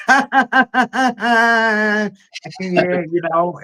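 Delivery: lightly put-on high, sing-song yeah; teasing the lady with a high, drawn-out yeah; high mock voice. To the lady about the shirt, a high teasing voice. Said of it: "I say".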